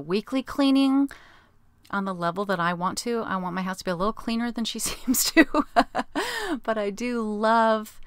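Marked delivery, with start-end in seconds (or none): 5.5-6.6 high-pitched chuckle; real, high giggle; laugh. Real, not acted. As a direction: laugh lightly at your own fussiness. Laughs at own cleaning standards.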